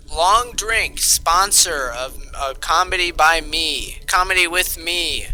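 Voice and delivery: big booming commercial voice; loud ad announcer. Pretend. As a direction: sell it like a TV ad. Spoof ad for a drink.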